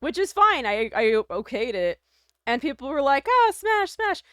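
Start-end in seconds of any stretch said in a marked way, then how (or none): 3.3-4.2 mock eager. Imitating commenters' eager replies.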